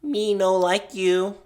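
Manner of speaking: high, slow toy voice; creepy Furby voice. Acted. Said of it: "its mechanical voice uttered".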